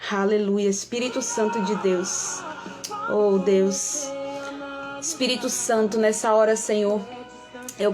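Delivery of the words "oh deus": normal talking voice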